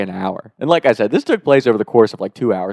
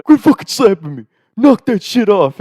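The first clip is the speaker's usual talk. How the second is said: loud, raised-pitch outburst; mock outrage at being slapped; angry retold yell; 9 semitones higher, 6 dB louder than usual. Drunk friend shaking the wrong kid, angry yell.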